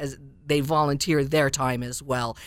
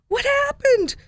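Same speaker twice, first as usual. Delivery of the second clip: a sudden much higher, startled squeak of a question; surprised mouse; 5 dB louder than usual. Acted. Alexander finds his friend in the toy box, surprised. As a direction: gasp it out, the little mouse can't believe it. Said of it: "said Alexander, surprised".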